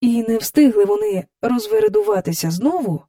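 ordinary delivery, nothing marked

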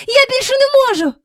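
very high, fast, breathless; strained. Acted. Michael exhausted by the string, high fast breathless cry. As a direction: blurt it out at the end of strength.

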